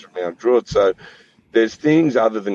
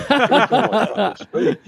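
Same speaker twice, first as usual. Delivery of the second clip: much higher, a jokey put-on voice; mock Joey voice. [name] retelling his mate's joke to the hosts, high mock voice. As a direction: toss off the punchline in a high comic voice. Punchline quoting Joey's joke.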